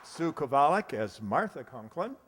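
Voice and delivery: ordinary talk, nothing marked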